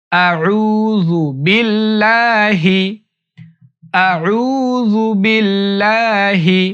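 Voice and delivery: আউযু বিল্লাহি recited slowly with the লা drawn out; slow careful recitation. Shown as a demonstration. Teacher reciting slowly for students to repeat. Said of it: "আমার সাথে সাথে পড়বেন".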